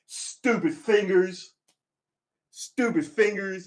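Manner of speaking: high, loud, drawn-out mock scolding; mock exasperation. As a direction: scold your own fingers slowly and loudly. Mock scolding own fingers.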